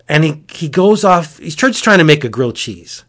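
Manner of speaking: ordinary delivery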